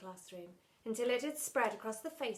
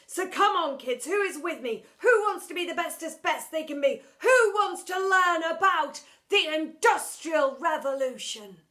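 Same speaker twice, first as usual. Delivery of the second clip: rising to a loud rallying cry; growing louder; 6 semitones higher, 12 dB louder than usual. Acted. Teacher rousing bored boys, louder and louder. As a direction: build from eager to booming pep talk. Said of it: "His voice was growing louder".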